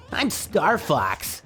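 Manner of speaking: high, drawn-out cartoon hero impression; Star Fox. Showing a friend how the game character sounds, mock character voice. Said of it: "that's what he fucking sounds like".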